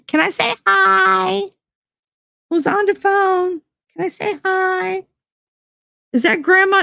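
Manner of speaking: put-on high, slow pleading child voice; mock little kid. Imitating her little daughter nagging to talk on the phone, high child voice. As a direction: beg like a small child.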